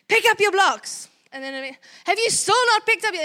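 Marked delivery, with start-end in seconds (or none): 0.1-1.1 high, loud snapped order; aggressive outburst. Retold or imitated. Mom's appeasing turning aggressive, loud snapped order. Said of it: "turns into the aggressive attitude". 2.0-3.2 high, loud exasperated yell; fed-up parent yelling; angry shout. Retold or imitated. Mom shouting at the child for not tidying, loud exasperated. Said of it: "started shouting".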